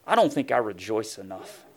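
normal talking voice

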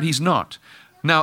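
usual speaking voice

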